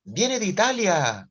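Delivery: slow, high and loud, savouring the word; teasing reveal. Retold or imitated. Teacher reveals the new boy is Italian, a slow teasing reveal. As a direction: dangle the news like a treat.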